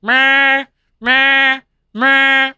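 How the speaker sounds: high, loud insistent meows; be the cat begging loudly; cat meowing. Retold or imitated. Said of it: "He goes up to you and he's like".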